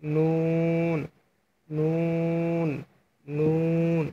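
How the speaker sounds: নুন drawn out long, tongue tip on the palate; slow, sustained নুন with a humming n; Arabic letter demo. Shown as a demonstration. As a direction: hold the নুন nasal long and steady. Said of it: "নুন হরফটি উচ্চারণ করবেন".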